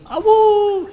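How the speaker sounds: high, loud wolf howl; one sharp wolf howl; howling like a wolf pup. Pretend. Howl ends the quote.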